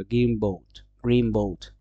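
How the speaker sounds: "গ্রিন বোট" said as greem-boat; linked "গ্রিন বোট", falling, with an m; assimilated form. Shown as a demonstration. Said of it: "এন সাউন্ডটা এম এ".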